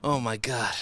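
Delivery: ordinary talk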